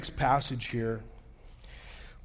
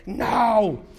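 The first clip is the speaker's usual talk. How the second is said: loud, high, shouted refusal; acted outrage; 8 semitones higher, 8 dB louder than usual. Biker rejecting pastor's suggestion, loud mock outrage.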